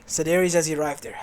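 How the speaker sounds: plain reading voice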